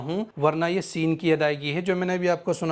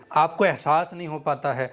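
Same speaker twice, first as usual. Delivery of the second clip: ordinary delivery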